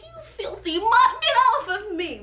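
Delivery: high, loud disgusted shout; mock disgusted yell. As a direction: shove him off with your voice. Esther repels Haman.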